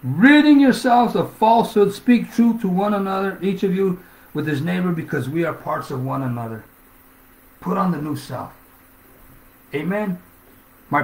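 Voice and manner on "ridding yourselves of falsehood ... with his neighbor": loud, high, slow preaching; preaching at full force. Acted. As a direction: thunder the verse out to the church.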